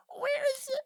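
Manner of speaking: very high small imp voice; timid imp. Acted. Little imp peeking through the door, asking in a tiny squeak. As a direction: peek out and ask in a tiny squeak. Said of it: "And they go".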